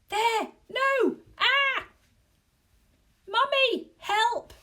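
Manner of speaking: very high, loud terrified shrieks; panicked shrieks. A narrator's reading. Wife shrieking at the ghost in the taxi, very high. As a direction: scream in panic.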